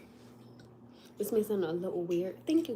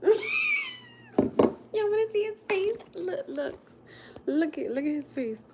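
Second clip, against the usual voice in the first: high laughing yell, much louder and slow; laughing shout; 13 semitones higher, 6 dB louder, much slower than usual. Real, not acted. Mother teasing her son's face to viewers, laughing loudly. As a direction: crack up while calling everyone over.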